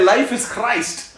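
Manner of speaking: slow, high, loud call; preaching. Shown as a demonstration. Preacher leading call and response, slow loud call. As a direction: call it out slowly for the congregation to repeat.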